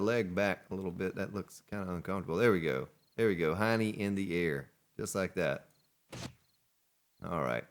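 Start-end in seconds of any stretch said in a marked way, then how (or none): none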